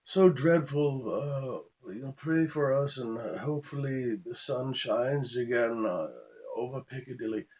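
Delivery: high, drawn-out English hand-wringing; mock English accent. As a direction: imitate a naive, lamenting type in a hammed-up English accent. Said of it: "I know that was England".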